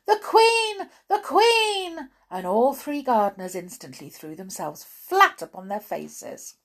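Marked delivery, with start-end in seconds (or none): 0.0-0.9 high, loud alarmed call. A narrator's reading. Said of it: "another gardener called out". 1.1-2.1 high loud repeated cry; calling out. A narrator's reading. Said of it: "another gardener called out".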